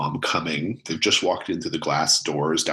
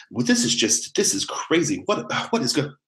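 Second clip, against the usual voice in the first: higher flustered ranger mom; 8 semitones higher than usual. Acted. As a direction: bustle in, flustered and bewildered. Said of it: "she's like".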